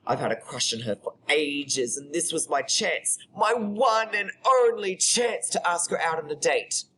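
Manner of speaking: animated build to a high, pleading finish; monologue performance. Acted. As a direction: build up to the one big chance. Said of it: "read it out to you".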